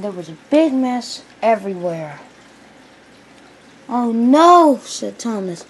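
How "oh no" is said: loud, high "oh no"; acted loud dismay; dismayed cry. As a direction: cry out at a disaster. Thomas reacts to the crash.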